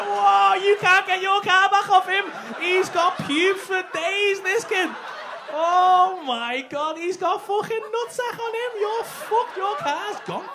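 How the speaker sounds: loud, high, laughing hype; roaring excited banter; hyped shouting. Real, not acted. Hyped reaction to the kid.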